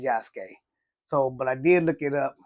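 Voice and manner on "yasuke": "Yasuke" in two syllables, YAHS-kay; "Yasuke" run together as yahs-kay; usual heard pronunciation. Shown as a demonstration. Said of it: "I've always heard it pronounced as".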